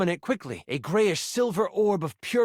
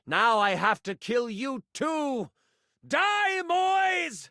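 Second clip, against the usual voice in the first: murderous villain; 6 semitones higher, much slower than usual. Acted. Villain attacks a new arrival, slow threat into high cry. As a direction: menace rising to a high cry.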